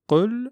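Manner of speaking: "Qul" with a light front k, high; "Qul" the mistaken way; wrong light qaf. Shown as a demonstration. Teacher shows the wrong "Qul", k sound at the front. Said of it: "c'est une erreur".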